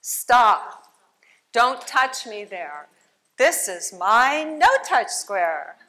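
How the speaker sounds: loud stop, slow firm rest; firm boundary shout. Shown as a demonstration. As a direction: demonstrate a strong no for kids. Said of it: "You could just like this, you go".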